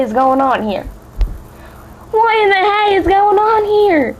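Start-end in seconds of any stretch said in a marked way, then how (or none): 2.1-4.1 Fluttershy asking, slower and much higher; higher, slow Fluttershy line. Shown as a demonstration. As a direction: lift the voice high and draw it out slowly. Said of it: "Who remembers that from chapter one?".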